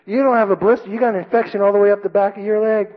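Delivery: high-pitched scolding sergeant; drill sergeant. Retold or imitated. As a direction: imitate a sergeant contradicting a recruit. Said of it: "drill sergeant walks behind me".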